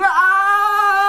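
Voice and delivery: put-on long high-pitched boyish scream. A narrator's reading. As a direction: hold a loud, piercing scream. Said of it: "screamed too".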